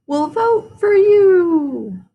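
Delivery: high, loud, very slow owl voice; drawn-out hooting owl speech. Acted. Mister Owl promising Froggy his vote, hooting.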